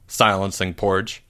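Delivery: plain reading voice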